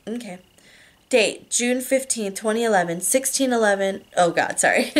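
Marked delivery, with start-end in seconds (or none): none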